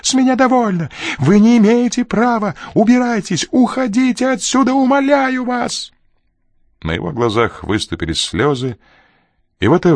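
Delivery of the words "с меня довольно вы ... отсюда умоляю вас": very high, loud, desperate cries; hysterical yelling. A narrator's reading. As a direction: scream in panic, begging them to leave. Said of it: "заорал Крю".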